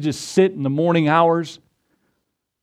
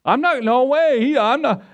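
high-pitched acted protest; alarmed refusal; 8 semitones higher than usual. Ananias refusing the Lord over Saul, high and alarmed. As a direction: balk in comic disbelief, voice up high.

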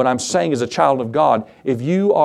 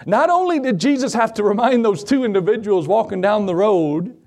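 high-pitched preaching with drawn-out key words; building sermon delivery, stretched and insistent; emphatic preaching; 6 semitones higher than usual. Real, not acted. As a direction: preach it slowly, pitch climbing phrase by phrase.

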